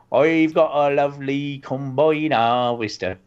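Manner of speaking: slow mock West Country farmer, rolled r in combine harvester; West Country accent. Shown as a demonstration. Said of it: "let's try that".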